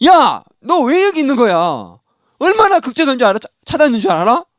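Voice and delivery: a mom yelling at her lost son; a mother's loud, high scolding of her runaway son. Retold or imitated. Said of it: "엄마도 호통치고".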